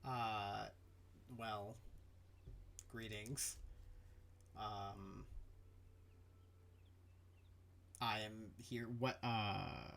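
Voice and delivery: low, quiet captain with halting pauses; softly spoken, low-voiced negotiator; hesitant captain. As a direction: speak low and measured, pausing between phrases. Game master plays a non-player character.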